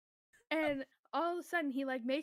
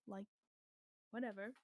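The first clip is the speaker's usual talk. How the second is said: ordinary talk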